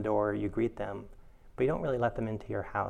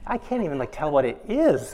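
higher, louder comic frustration; high mock exasperation; 5 semitones higher, 9 dB louder than usual. Teacher retelling the tiny itch, high mock exasperation. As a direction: squeak out the disbelief.

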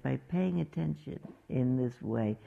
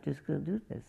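normal talking voice

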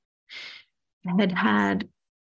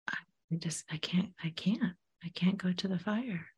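whispered, softer fearful refusal; 9 dB quieter than usual. Retold or imitated. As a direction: breathe it out unable to go. Client's repeated whispered refusal.

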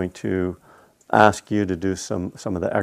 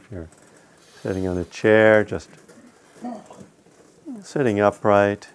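guided meditation. Acted. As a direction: lead them unhurriedly into stillness, phrase by phrase.

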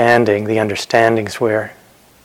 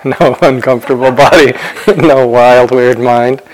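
louder, laughing between phrases; amused, laughing; 5 dB louder than usual. Real, not acted. Meditation teacher joking with retreatants about troublesome bodies, amused and laughing. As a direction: let chuckles break into the phrases.

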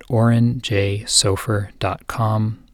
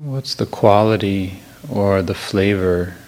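guided meditation; 2 semitones lower than usual. Real, not acted. Teacher easing a listener into the morning, calm guided meditation. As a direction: guide gently, unhurried, phrase by phrase.

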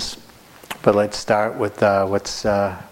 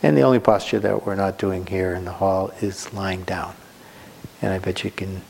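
ordinary delivery

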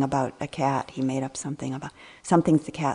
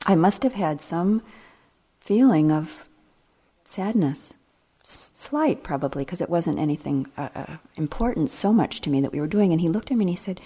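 ordinary delivery

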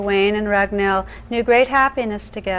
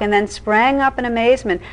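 usual speaking voice

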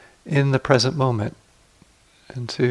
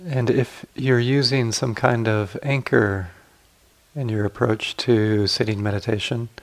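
guided meditation. Acted. Teacher guiding sitters to relax the body, calm guided meditation.

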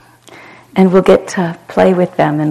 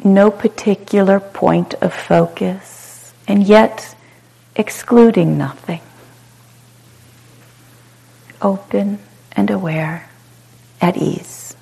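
calm, unhurried meditation guide with long pauses; short soothing phrases, falling gently into silence; guided meditation; 13 semitones higher, 5 dB quieter than usual. Real, not acted. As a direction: guide them into spacious ease, letting each phrase settle.

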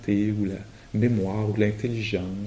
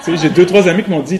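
fast and louder, laughing as he talks; 7 semitones higher, 13 dB louder, faster than usual. Real, not acted. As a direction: tell it while still laughing. Amused story about appendix.

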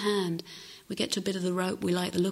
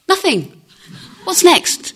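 put-on high, loud eager little girl; eager child's outburst; 10 semitones higher, 13 dB louder than usual. Little girl answering the husband, loud and eager. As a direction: blurt it out like a bouncy three-year-old. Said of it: "And she went".

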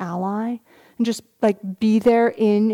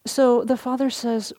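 ordinary talk

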